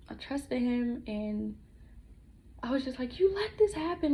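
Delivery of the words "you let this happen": high, louder accusing cry, short of screaming; retold scream. Speaker recalling how she blamed God, retold scream. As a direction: raise the voice sharply in recalled accusation. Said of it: "I was really screaming".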